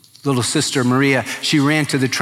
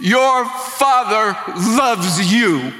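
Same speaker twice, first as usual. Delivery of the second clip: high, slow call out of a car window; yelling to son; 7 semitones higher, much slower than usual. Retold or imitated. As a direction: call his name across the yard, slow and high. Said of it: "he yelled out to his son".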